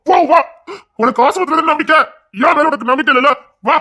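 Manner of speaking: high-pitched, agitated reproach; hurt, angry challenge. Acted. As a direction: accuse them of not trusting you. Husband accuses wife of distrust.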